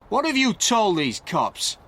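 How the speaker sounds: high, slow angry demand; angry shout. A narrator's reading. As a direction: Stewart shouting angrily at Frank. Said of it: "Stewart shouted angrily at Frank".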